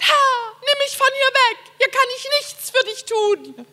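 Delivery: high, wailing mock lament; hammy stage actor. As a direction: whine it out like a stage drama. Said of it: "hab ich mal so vor Gott gejammert".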